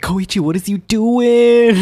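slow, high incredulous call; exasperated mock call. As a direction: drag it out in disbelief.

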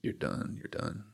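soft unvoiced whisper, repeated; God's whisper. God telling him he is done, hushed whisper. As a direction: whisper the verdict gently. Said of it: "he was like".

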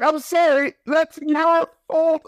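high-pitched mock apology, last words drawn out; exasperated retort. Viewer telling off gullible film characters, exasperated.

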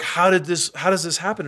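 high, baffled question; lightly voiced amazement; amazed bosses. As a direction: play the stunned HR people. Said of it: "they're like".

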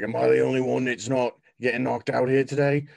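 loud, high, fed-up dwarf yell; exasperated cleric. Acted. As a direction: shout it out, exasperated. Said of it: "be like".

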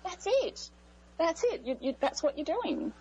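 high, eager agreeing; excited agreement. Real, not acted. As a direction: jump in with delight.

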